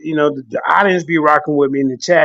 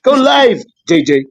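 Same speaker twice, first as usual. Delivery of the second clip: higher, insistent imitation of another man's accent; Garfield's accent; 4 semitones higher, 3 dB louder than usual. Garfield pushing his friend to start streaming, imitated accent. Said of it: "When, when Garfield said".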